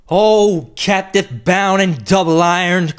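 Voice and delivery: loud, high wail of a tormented phantom; anguished cry. A narrator's reading. Phantom cries out at Scrooge, loud high anguished wail. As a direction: cry out in torment, drawing the words out. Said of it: "set up another cry".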